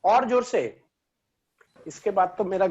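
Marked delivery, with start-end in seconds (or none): 0.0-0.6 raised, higher and louder questioning; louder on request. Real, not acted. Teacher asked again to speak louder, asks back in a raised voice. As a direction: lift the voice, half exasperated. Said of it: "थोड़ा सा जोर से बोलिए ना".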